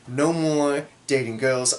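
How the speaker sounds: ordinary delivery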